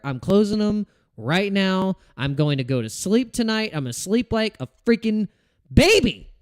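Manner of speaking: heated outburst at his trades; angry. Real, not acted. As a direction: vent real frustration at the market. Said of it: "the anger in my voice".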